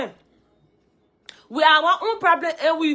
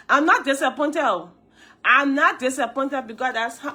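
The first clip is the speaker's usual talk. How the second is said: ordinary delivery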